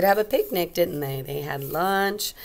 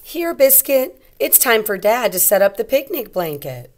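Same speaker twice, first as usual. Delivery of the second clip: louder, bright storybook voice; reading to children; 4 semitones higher, 7 dB louder than usual. A narrator's reading. Teacher reading a picture book aloud to young kids.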